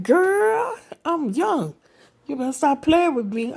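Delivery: high, loud mock protest; woman imitating a blushing young man, high and loud; embarrassed young man. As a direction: squeak it out like a flustered young guy. Brother reacts, embarrassed.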